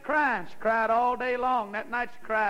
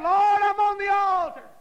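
high, loud, long-held plea; retold prayer cry; 7 semitones higher, 5 dB louder than usual. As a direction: cry it out to heaven in her place. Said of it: "And she kept saying".

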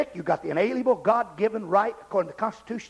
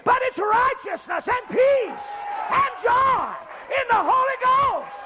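high, building revival shout; full-force preaching; 9 semitones higher than usual. Real, not acted. Preacher saying church is more than socializing, full-force holler. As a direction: build each refrain to a shouted peak.

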